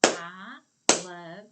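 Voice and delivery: each word called loud and separate; big loud classroom chant; clapped out loud. Shown as a demonstration. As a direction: shout it word by word with claps. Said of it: "and clap".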